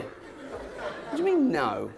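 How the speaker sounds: very high, drawn-out mock outrage; indignant disbelief. Man challenging a refusal in an acted argument, indignant. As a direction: stretch it into an incredulous squeal. Acted quarrel with the dog owner.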